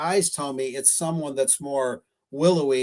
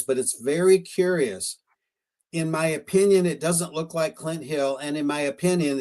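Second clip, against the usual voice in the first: normal talking voice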